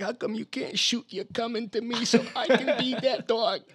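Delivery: high-pitched put-on woman's voice; mock whiny plea. Mimicking Carol wanting babies, high mock plea.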